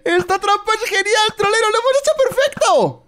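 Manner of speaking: high delighted praise; thrilled with the trap; excited triumph. Real, not acted. Trap worked, celebrating.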